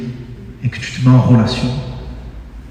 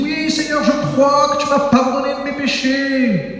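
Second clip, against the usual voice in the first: high, drawn-out devout declaration; mock-pious prayer; 11 semitones higher, slower than usual. Believer telling the Lord they feel forgiven, mock-pious. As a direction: imitate an over-earnest believer, high and slow. Mimics believers praying aloud.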